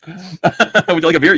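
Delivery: drawn-out teasing offer; hammed-up recruiter. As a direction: hold out the beer slowly. Recruiter quote, laughing.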